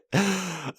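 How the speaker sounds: high startled gasp; dog barks again, dismayed gasp; sharp dismayed gasp. Real, not acted.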